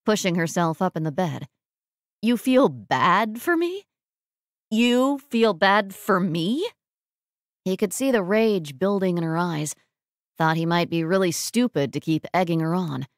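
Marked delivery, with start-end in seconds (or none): 2.2-6.7 incredulous anger. Acted. Sick woman enraged by his sympathy, incredulous. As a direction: stretch it out in disbelief, rising at the end.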